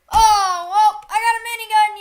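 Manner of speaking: fast, loud gleeful call; excited. Real, not acted. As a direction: rattle it off, thrilled. Gets a new weapon.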